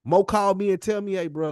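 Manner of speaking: ordinary talk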